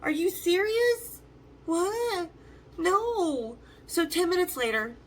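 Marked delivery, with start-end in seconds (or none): 0.0-3.6 high-pitched, incredulous outburst; retold freak-out. Stranded group reacts to being left in the elevator, high incredulous freak-out. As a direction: replay stunned disbelief, pitched up and stretching the words. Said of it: "We just kinda freaked out".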